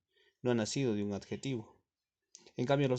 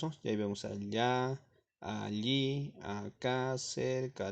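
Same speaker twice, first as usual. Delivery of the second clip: ordinary talk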